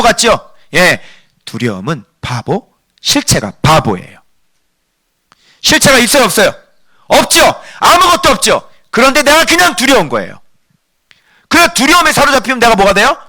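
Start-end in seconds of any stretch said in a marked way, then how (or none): 5.6-13.2 high, insistent sermon voice; impassioned preacher at high pitch; forceful preaching. Real, not acted. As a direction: drive the questions home to the congregation. Preacher presses the congregation.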